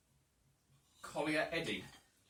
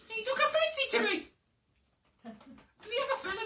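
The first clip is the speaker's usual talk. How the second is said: high-pitched Cockney woman, quick and chatty; gossiping Cockney lass at the inn; Cockney lady accent. Acted. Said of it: "brilliant Cockney lady accent".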